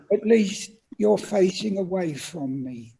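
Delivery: be the small polite elf in the crowded lift; Dobby the house elf, squeaky and polite; little elf voice. Retold or imitated. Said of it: "And he said".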